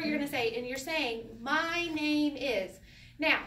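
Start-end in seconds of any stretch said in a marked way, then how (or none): 1.4-2.8 slow, deliberate formal self-introduction; stiff. Shown as a demonstration. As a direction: stiffly space out each word. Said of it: "this is so, like, stiff".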